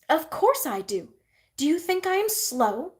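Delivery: high indignant retort; sassy. A narrator's reading. Nor answering her aunt back, sassy high retort. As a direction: toss it back with cheek. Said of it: "responded Nor sassily".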